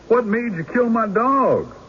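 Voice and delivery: mock angry farmer. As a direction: [name] hams up the outraged farmer.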